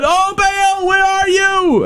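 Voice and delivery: hammed-up pleading to an absent god; mock cry to Baal. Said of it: "cry to your God".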